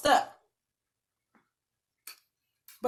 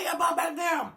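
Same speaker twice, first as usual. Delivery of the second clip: high-pitched motherly command; mama's order; 8 semitones higher, slower than usual. Retold or imitated. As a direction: imitate a mother ordering kids back down. Imitates mama ordering him to bed.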